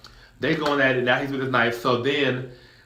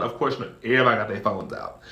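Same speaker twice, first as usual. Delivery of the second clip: normal talking voice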